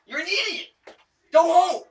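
loud, high furious yell; angry shout. Real, not acted. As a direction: shout him off the property. Yelling at drunk neighbour.

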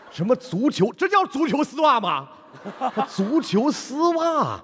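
high, mocking disbelief; incredulous. Real, not acted. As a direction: scoff in amused disbelief. Judge mocks the joke incredulously.

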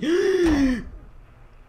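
sharp frightened gasp, high; scared gasp. Real, not acted. Jump scare in horror game.

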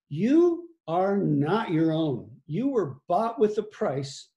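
"You are not your own" said very slowly, each word weighted; slow emphatic scripture. Acted. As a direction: let the verse land slowly and heavily.

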